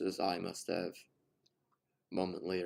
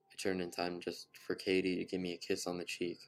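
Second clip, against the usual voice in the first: plain reading voice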